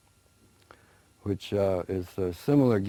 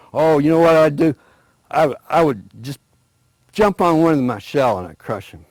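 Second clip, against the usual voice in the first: loud, higher bragging voice; boastful tortoise; 5 semitones higher, 9 dB louder than usual. Acted. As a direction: brag it, puffed up.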